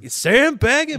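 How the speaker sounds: high, loud, hyped TV-host yell; shouting like a fired-up sports host; Pat McAfee impression. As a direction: channel a hyped sports broadcaster. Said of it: "Shout out Pat McAfee".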